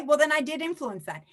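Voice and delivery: ordinary delivery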